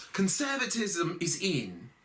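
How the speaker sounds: "Conservatism is in" in a high British accent. Shown as a demonstration. Comic redoes Theresa May's line in a British accent. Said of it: "we gotta be British".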